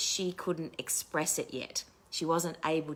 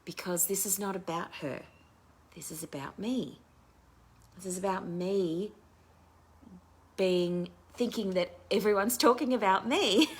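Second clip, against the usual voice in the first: ordinary talk, nothing marked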